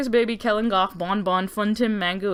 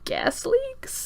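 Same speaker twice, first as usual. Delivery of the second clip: much higher, softer, doubtful squeak; high, unsure excuse; 6 dB quieter than usual. Retold or imitated. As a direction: float the lie up timidly. William inventing a lame excuse.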